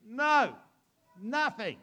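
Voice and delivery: acted shout back across the water; calling back. Tired fishermen answering the man on shore, calling back.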